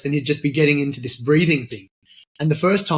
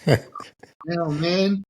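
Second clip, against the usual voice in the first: mock dismay; 4 semitones higher, slower than usual. Mock dismay at dry trip.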